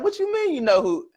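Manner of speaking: sharp high disbelief; how do you know me?; incredulous. Retold or imitated. Speaker stunned that Gary knows him, high disbelief.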